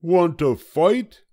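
high, loud, grumpy little bug; a tiny bug spoiling for a fight, high and loud; grouchy ladybug. A narrator's reading. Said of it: "said the grouchy ladybug".

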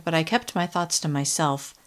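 ordinary delivery

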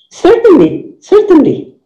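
"Certainly" starting high, then falling; expressive business reply. Shown as a demonstration. Teacher models agreeing to a customer, expressive high-falling "certainly". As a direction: pitch "certainly" up high and let it drop. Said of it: "itu mengenai ekspresi".

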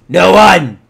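sudden loud, high outburst; emphatic shout. Pretend. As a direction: bark it as a stern warning. Insists on the secret.

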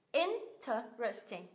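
interesting slowly as 'in... te... res... ting'; slow, spaced demo of interesting; four separate syllables. Shown as a demonstration. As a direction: count out each syllable of interesting. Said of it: "Bốn âm tiết".

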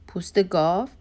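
mild astonished question. A narrator's reading. Master echoing the servant girl's answer, astonished. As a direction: echo it back in mild surprise. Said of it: "امیر با تعجب گفت".

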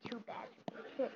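soft unvoiced repeat of too bad; whispered echo. Shown as a demonstration. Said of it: "But I got it too bad".

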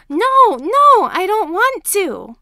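put-on a kid kicking and refusing to come in; screaming tantrum. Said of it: "screaming comes from the hallway".

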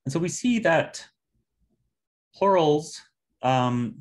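ordinary talk, nothing marked

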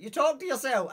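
put-on high, loud old gran voice; gran's voice. Gran catches him talking to himself, high and loud.

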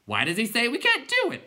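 high, loud clown question; clown creature voice. A narrator's reading. Clown asking the others why, high and loud. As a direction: ask it in a silly high voice.

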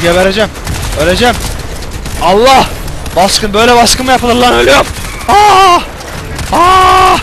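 loud, high, frantic cries; loud desperate cries of pain; panicked wailing. Real, not acted. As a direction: wail like you are dying. Dying in an ambush.

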